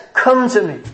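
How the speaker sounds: crying out. Acted. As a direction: call sinners in with urgency, pitch held high. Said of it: "Do you hear him crying out saying".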